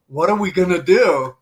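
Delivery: hammed-up worried cry; mock panic. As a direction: replay the rush of nerves at the door. Retold panic driving to first delivery.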